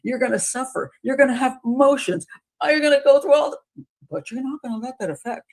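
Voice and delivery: mock courtroom theatrics rising to a loud peak; hammed-up lawyer. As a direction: imitate an attorney milking the emotion. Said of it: "he's playing that for all it's worth".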